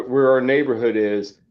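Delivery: ordinary talk, nothing marked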